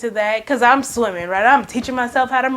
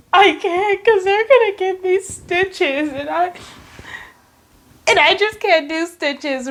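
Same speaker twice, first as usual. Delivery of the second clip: higher, pleading protest; mock panicked whine; 6 semitones higher than usual. Niece on the phone to aunt about stitches, mock panicked pleading. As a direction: plead up high, acting out dread.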